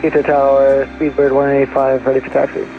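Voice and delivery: radio headset voice; pilot's voice through cockpit radio; over a pilot headset. Real, not acted. Pilot calling tower by radio.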